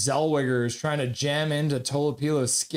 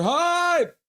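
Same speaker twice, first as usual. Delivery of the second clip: high, drawn-out holler; excited fan call; acted hype shout; 5 dB louder than usual. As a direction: stretch the word into a crowd yell. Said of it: "Colin Whitehead's like".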